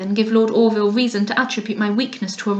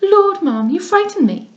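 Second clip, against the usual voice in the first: high, louder mock fright; affected alarm; 9 semitones higher, 3 dB louder than usual. Lady Louisa pretends to be frightened by the hour, high. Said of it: "cried Lady Louisa".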